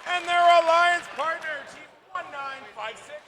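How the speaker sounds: loud arena announcer intro; loud announcer introducing alliance teams; match announcer. Acted. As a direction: boom the team names to the crowd.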